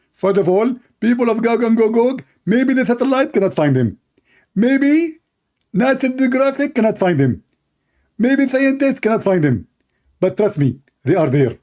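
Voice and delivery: mock the opponent's confident rebuttal; high mock debater. Mock opponent argues back.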